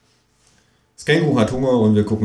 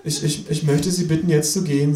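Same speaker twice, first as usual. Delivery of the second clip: high, nervous stutter; stammering cashier; 7 semitones higher than usual. Acted. Flustered cashier asks the kangaroo to leave, high stutter. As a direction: stumble over the first word. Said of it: "sagt der Junge".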